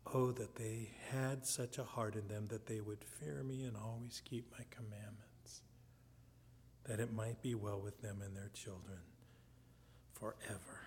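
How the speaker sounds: soft, low lament, fading at the end; heartfelt cry. Acted. Preacher voicing the Lord's wish for his people, quiet heart cry. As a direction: let it sink quietly to "forever". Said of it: "the heart cry of the Lord".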